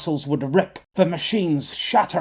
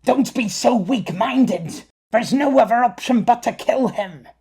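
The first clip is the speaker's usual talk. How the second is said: angry shout; 7 semitones higher, 3 dB louder than usual. Acted. Flicker angrily argues to kill.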